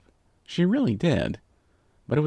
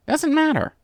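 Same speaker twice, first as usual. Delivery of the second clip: high, chirpy brush-off through a grin; grinning; 5 dB louder than usual. A narrator's reading. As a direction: say it with a big grin, pitched way up. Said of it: "Rainbow grinned".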